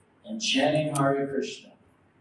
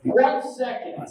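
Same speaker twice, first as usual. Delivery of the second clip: mildly shouted, higher pitched answer; yelled reply; 6 semitones higher than usual. Retold or imitated. Teacher answers a follower's question, yelled back. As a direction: fire it back, raised and emphatic. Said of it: "Prabhupada yelled back".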